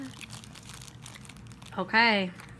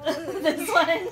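laughing; 8 semitones higher, 5 dB louder than usual. Real, not acted. Laughing at the sour face.

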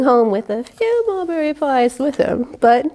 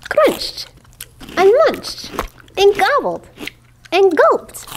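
gleeful; 7 semitones higher than usual. A narrator's reading. Eating words read with gusto.

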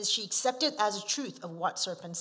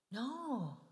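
ordinary delivery

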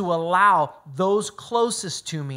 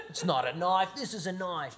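put-on a light Australian accent, softly spoken with a laugh; joking Australian accent with a chuckle; Aussie accent; 9 dB quieter than usual. Mock Aussie accent, half laughing, for the men in the room. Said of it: "that was a pretty good Aussie accent".

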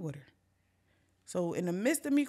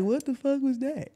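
loud, higher startled question; startled reaction; 4 semitones higher, 6 dB louder than usual. Retold or imitated. Friends in the basement hearing a noise, startled. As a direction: act out being caught off guard.

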